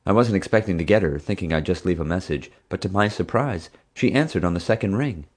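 ordinary delivery, nothing marked

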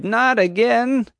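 very high, slow wailing dismay; dismayed cry. Acted. Keeper watching the herd stampede, dismayed wail. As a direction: wail it out in despair. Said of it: "Bonnie cried".

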